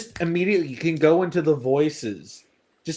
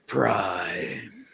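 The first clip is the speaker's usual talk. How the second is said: Megatron without modulator; 6 semitones lower, 3 dB quieter than usual. Retold or imitated. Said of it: "without the voice modulator, where he's just like".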